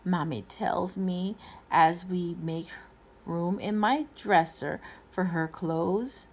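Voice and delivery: usual speaking voice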